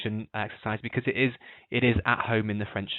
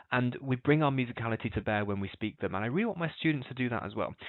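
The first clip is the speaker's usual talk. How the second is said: usual speaking voice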